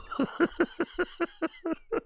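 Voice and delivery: high, breathless cackle; long helpless laughing fit; wheezing laugh. Real, not acted. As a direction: crack up at your own dark joke. Laughs at own dark joke.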